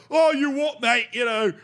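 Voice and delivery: half-baked Scottish hard man; failed Scottish accent. Acted. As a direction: goad him in a badly faked Scottish accent. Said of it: "I'm not doing a Scottish accent".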